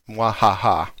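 hammed-up evil villain cackle; a mock-sinister laugh to tease the player; sneering panto villain. GM teasing player about resources.

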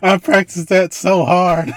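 slow, laughing through the words; giggling at his own flub. Real, not acted.

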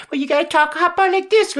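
impersonator demo. Retold or imitated. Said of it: "good impersonator breaks down and dissects".

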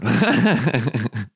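short amused laugh. Real, not acted. Laughs at robot.

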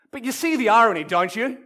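usual speaking voice

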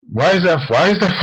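asked through real laughter; giggling rhetorical question; real amused laughter breaking in; laughing. Real, not acted. Laughing at her own admission.

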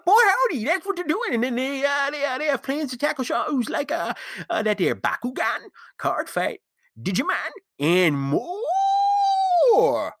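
put-on high, hollering old prospector voice. Continues prospector ad read.

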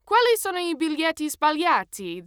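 high, loud, aggressive bark; barked Italian. A narrator's reading. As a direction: bark it at the passenger as an accusation. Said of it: "the man barked at me".

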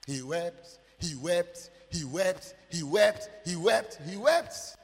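insistent chant-like build on "wept"; mounting repetition. Retold or imitated. Repeats the weeping, building.

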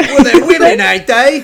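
high, loud cartoon British voice; hammed-up British accent, nearly laughing; mock British accent. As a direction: squawk it as an over-the-top Brit. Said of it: "put on a British accent".

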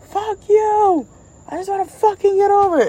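high, loud, fast cartoon rant; mock furious yell. Stan raging at the blocking driver, high fast yell. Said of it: "And he's like".

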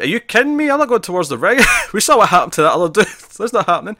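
high, loud panicked disbelief, laughing through it; panicked Alex. Retold or imitated. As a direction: laugh in alarm at the idea of heading for the ring. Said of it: "And then Alex is like".

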